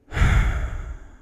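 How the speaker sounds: long, loud weary exhale; exasperated sigh. Acted. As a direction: let out a fed-up sigh. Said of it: "gives an exasperated sigh".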